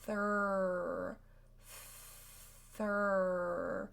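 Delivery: Thur stretched, TH sliding into a curled R; held Thur drill. Shown as a demonstration. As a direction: draw out Thur, tongue pulling back. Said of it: "the tongue is at the top of your mouth".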